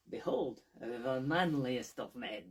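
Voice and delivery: loud, slow theatrical "behold"; slow loud mock unveiling; grand proclamation. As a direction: drag out "manliest" mockingly. Diogenes parody presentation.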